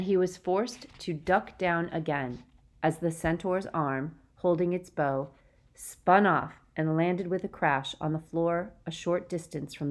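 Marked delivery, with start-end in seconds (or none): none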